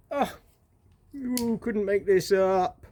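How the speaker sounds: long incredulous drawl; exasperated disbelief. Real, not acted. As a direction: stretch out "you couldn't make this up". Exasperated repeat, contracted then full.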